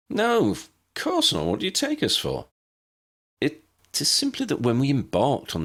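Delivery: indignant. Acted. Hermione indignant at Ambrose's worry.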